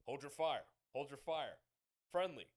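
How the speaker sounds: calling out hold your fire over voice chat; mock comms call. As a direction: hail a stranger in a raid, then the mock callout. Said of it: "you're like".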